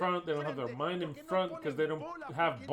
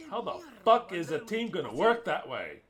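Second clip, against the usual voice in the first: the question again, pushed louder and cursing; louder angry repeat; 5 dB louder than usual. Real, not acted. Angry fan at the coach, the question repeated louder. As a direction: swear through it, building the anger.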